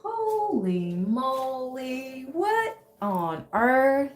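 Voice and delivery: slow, drawn-out holy moly; stunned by the hawk call, stretched out; surprised. Real, not acted. Viewer hears the hawk call, stunned and slow.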